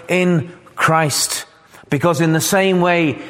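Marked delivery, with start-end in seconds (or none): 0.1-1.4 "in" punched, "Christ" lighter after it; stress on IN. Shown as a demonstration. Preacher singling out the phrase for the congregation, stressing "in". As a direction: hit the word "in" in "in Christ". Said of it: "and I emphasize that word".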